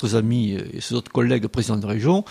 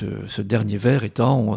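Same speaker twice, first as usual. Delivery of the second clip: ordinary delivery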